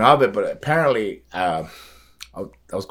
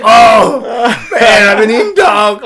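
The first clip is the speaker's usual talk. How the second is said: high, loud dismay; shocked realization; 11 semitones higher, 9 dB louder, slower than usual. Retold or imitated. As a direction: let the shock hit you.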